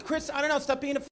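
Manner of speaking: imitated dismissive scolding; fed-up woman. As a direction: brush him off like an impatient relative. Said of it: "She's the type".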